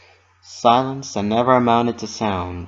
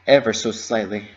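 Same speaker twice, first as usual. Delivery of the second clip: ordinary delivery, nothing marked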